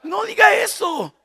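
high laughing shout. Real, not acted. Preacher shouting a warning at wives. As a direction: cry it out, half laughing.

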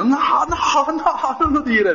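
very high-pitched mock alarm, a drawn-out siren of no's; imitated guardian angel. Guardian angel stops the preacher from saying the wrong word, high mock warning. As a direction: cry "no" high and long, like an emergency warden stopping someone. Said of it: "l'angelo custode vestito da pompiere, da vigile urbano".

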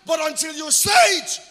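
shouted, high and loud sermon climax; preaching at full force. Acted. Pastor rousing the church about authority, shouting.

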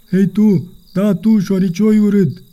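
high, slow taunting yell. A narrator's reading. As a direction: call the monster out, high and slow. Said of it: "urlă Toni".

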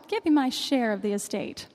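ordinary talk

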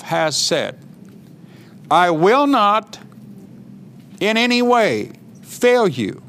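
slow, weighty scripture reading, each phrase set apart; emphatic verse reading with long pauses. Acted. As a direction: declare each promise phrase by phrase. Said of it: "you have to see how emphatic that is".

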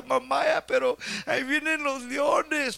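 a hint of a child in tears; crying child. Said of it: "el niño llorando con lágrimas decía". (niño asustado, un poco lloroso)

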